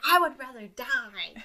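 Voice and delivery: loud, slow, drawn-out mock tantrum; hammed-up defiant whine; throwing a fit. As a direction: stretch it out like a melodramatic child. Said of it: "There's, like, a whole fit".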